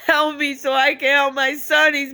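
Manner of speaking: high, tearful cry for help; real anguish, pitched high; desperate pleading. Real, not acted. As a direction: beg a stranger urgently through tears. Begging a bystander for help, in tears.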